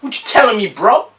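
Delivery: a hint of an American street accent. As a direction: greet your homie, American style. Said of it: "I can do American".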